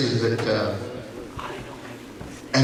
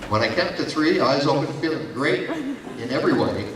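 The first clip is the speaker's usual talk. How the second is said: ordinary talk, nothing marked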